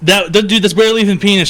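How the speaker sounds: excited protest. Real, not acted. Laughing protest at a drawing.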